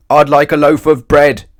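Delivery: big booming shout. A narrator's reading. Mister Noisy booms at the baker's wife. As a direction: boom it across the shop. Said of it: "boomed Mister Noisy".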